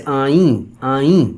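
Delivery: آئیں ending in nasal long 'ee'; yaa-e maroof. Shown as a demonstration. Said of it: "جس کی کہ ای کی آواز". (آئیں کے آخر میں 'ای' کی غنہ آواز)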